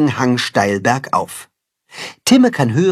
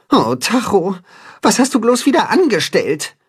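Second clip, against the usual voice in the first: sighing; 6 semitones higher than usual. A narrator's reading. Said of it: "seufzt Timme".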